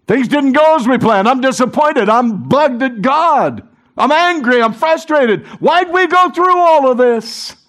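hammed-up disciple venting disappointment; frustrated complaining.

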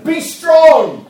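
very loud, high, ringing command; shouted exhortation. Acted. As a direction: shout courage into them. Preaching exhortation.

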